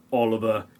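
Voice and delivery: ordinary delivery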